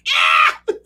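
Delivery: very high, loud yell of triumph; high, loud victory yell; cheering shout. Retold or imitated. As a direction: shout it like a stadium crowd. Players cheering together.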